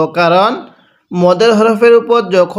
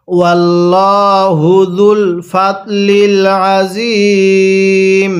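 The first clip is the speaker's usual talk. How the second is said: slow chant with lengthened vowels; slow recitation; slower than usual. Shown as a demonstration. Teacher reciting the verse ending. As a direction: stretch each word. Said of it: "কিভাবে পড়ব?".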